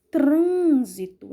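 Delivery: "Trânsito" with a long, loud closed TRÂN; stressed closed syllable. Shown as a demonstration. Teacher shows pupils the closed sound, stressed TRÂN in "Trânsito". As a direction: stretch the TRÂN in "Trânsito", mouth nearly shut. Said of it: "eu fecho um pouco a boca".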